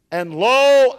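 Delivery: And lo called out loud; proclaiming. Acted. Preacher announcing Jesus' promise, loud call. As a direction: lift And lo up as a proclamation. Climax of the scripture reading.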